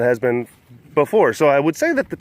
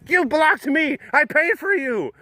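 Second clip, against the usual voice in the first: possessive; 12 semitones higher than usual. Pretend. Owner scolding laptop.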